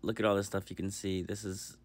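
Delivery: soft, calm recorded voiceover; voiceover narration. Real, not acted. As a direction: narrate quietly over the footage. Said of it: "it is voiceover time".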